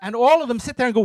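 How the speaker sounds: normal talking voice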